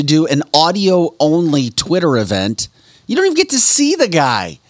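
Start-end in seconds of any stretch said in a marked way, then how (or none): 3.1-4.6 incredulous. Real, not acted. Incredulous outburst.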